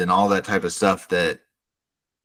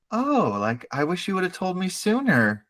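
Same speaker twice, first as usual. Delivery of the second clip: higher, lightly put-on pleasant voice; fake smiling politeness; 8 semitones higher, 4 dB quieter than usual. Senator smiling at her blackmailer, fake politeness. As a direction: play the niceties through a forced smile. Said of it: "smile at him like".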